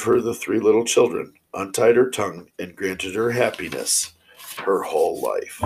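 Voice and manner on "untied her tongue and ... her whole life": ordinary delivery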